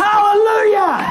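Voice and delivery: high, slow jubilant cry; triumphant hallelujah. Acted. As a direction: shout it like a revival preacher. Triumphant reveal to audience.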